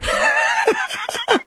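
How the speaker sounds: high shout of laughter; cracking up hard. Real, not acted. Host laughs at mariachi bit.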